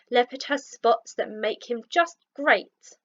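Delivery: ordinary delivery, nothing marked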